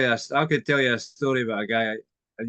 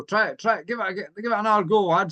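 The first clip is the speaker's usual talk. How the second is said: high urgent call; 8 semitones higher, 4 dB louder than usual. Retold or imitated. Police urging push.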